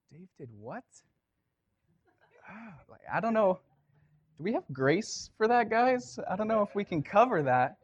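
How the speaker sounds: high, fretful and unsure; a flustered God fussing over grace; mock worried God. Mock God panicking over sin.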